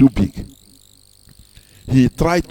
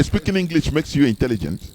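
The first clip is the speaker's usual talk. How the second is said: ordinary talk, nothing marked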